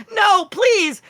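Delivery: high, loud desperate plea; pleading cry. Acted. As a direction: beg for mercy up high. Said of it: "He goes like".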